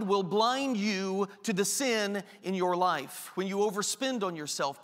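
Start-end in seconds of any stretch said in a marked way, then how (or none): none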